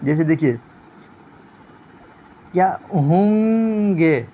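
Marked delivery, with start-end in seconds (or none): 2.9-4.3 ہوں گے with a long nasal hum on ہوں; drawn-out nasal vowel. Shown as a demonstration. Teacher demonstrates noon ghunna in mid-word, drawn-out nasal. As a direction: stretch ہوں through the nose, then add گے. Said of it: "جو ناک سے پڑھا جائے".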